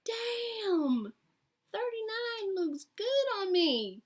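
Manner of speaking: high, slow, admiring tone; drawn out, pleased with herself; self-impressed. Retold or imitated. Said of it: "And I thought".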